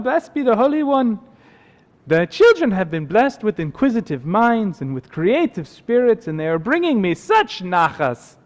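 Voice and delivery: put-on high, lilting accented old-world voice; contented water carrier. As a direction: count blessings in a swooping accent. Plays the now contented water carrier.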